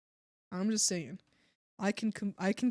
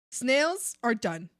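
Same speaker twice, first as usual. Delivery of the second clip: slow, louder, each word weighted; firm decree; 6 dB louder, slower than usual. Acted. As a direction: lay down the law.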